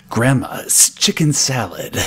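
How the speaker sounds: slow hissing whisper; snake voice whisper. Shown as a demonstration. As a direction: breathe it out like a sneaky snake. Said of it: "Here, check this out".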